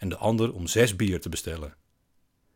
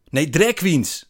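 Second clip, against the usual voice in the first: loud, high impatient correction; exasperated correction; 9 semitones higher, 9 dB louder, slower than usual. A narrator's reading. Man corrects narrator's misunderstanding, exasperated. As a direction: correct him with raised voice. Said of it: "zegt hij".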